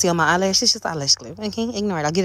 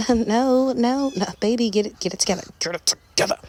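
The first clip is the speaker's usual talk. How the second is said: mock scolding; 9 semitones higher than usual. Scolding the rhinestone playfully.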